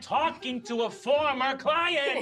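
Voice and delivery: put-on whiny shout; exasperated child to mom. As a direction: whine it at a parent, exasperated. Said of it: "turn into her mom".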